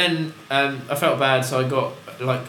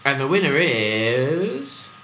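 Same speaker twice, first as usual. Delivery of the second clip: announcer voice; much slower than usual. Pretend. Awards announcer voice.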